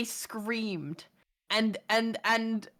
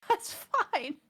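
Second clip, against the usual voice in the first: high, gasping little laugh into the words; 10 semitones higher, 4 dB quieter than usual. Retold or imitated. Dog walker brushing off the scare, imitated high gasping laugh. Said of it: "she was just laughing it off".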